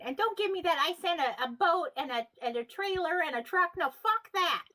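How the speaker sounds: re-enacted outraged scolding; angry rant. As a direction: tear into them, building to an exasperated peak. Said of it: "I get really mad".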